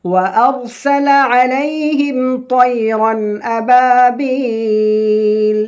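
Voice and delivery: slow, high melodic chanting of the verse; Quran recitation. Shown as a demonstration. Teacher opening the Surah lesson with slow high recitation.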